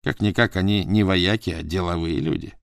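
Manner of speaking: plain reading voice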